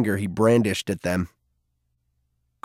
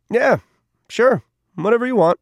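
put-on much higher, dismissive kid voice; careless teen shrug-off; 10 semitones higher, 3 dB louder than usual. Cole brushing off Garvey's request, higher and dismissive. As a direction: toss it off like you couldn't care less.

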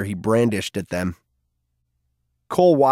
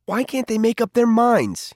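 put-on much higher, whiny teen voice; mild complaint; 10 semitones higher than usual. A narrator's reading. Cole complaining to Garvey about the Circle, higher and whiny. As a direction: whine it like a fed-up kid. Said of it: "he complained".

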